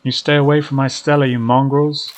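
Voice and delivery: loud character voice defending someone; angry threat. Acted. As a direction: warn them off fiercely. Duke threatens unseen creatures.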